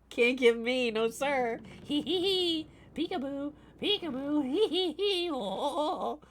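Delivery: high sing-song teasing with giggles; childish taunt. Acted. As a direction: taunt him like a kid playing hide and seek. Taunting the angry chatter.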